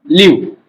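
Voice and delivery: लीव said slowly with a long ee, as a model word; modelled word leave. Shown as a demonstration. Teacher models लीव for students with a long ee. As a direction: sound out लीव clearly with a long ee for the class. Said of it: "क्या होता है ये".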